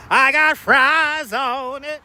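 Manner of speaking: high, slow, drawn-out fries line; hammed-up slow customer. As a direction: stretch it out like a goofy customer. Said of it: "the co-workers always said".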